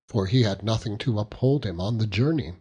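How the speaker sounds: ordinary delivery, nothing marked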